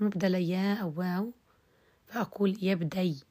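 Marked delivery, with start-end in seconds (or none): 2.6-3.2 يبدي closed on a y glide, no final vowel; ibdal to ya. Shown as a demonstration. Teacher follows al-Akhfash's school, hamza replaced by vowelless ya. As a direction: say يبدي as "yabday", hamza turned into ya. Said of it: "إبدالها ياء".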